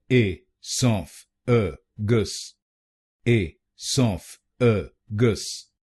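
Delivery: very slow, each syllable "ए सॉफ अ गस" apart. Shown as a demonstration. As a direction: spell it out slowly in syllables. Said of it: "तो बनेगा".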